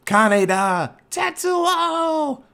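high, drawn-out mock yell; hammed-up anime shout climbing high; anime name yelling. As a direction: cry out rival names like anime characters. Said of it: "They yell so damn much".

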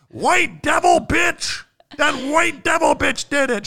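high, slow accusing yell; hammed-up cry from someone in a crowd; heckler's shout.

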